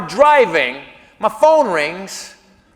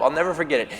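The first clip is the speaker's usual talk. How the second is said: ordinary delivery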